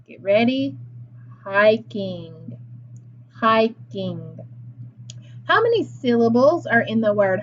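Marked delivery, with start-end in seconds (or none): 1.4-2.6 HIKING said slowly in two syllables; "Hiking" stretched out for listeners to examine; word demonstration. Shown as a demonstration. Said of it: "examine the word hiking". 3.3-4.4 HIKING said slowly in two syllables; "Hiking" stretched out for listeners to examine; word demonstration. Shown as a demonstration. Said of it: "examine the word hiking".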